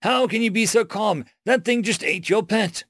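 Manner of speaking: high, agitated protest; worked-up, incredulous high voice; flustered. A narrator's reading. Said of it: "stuttered Biograt".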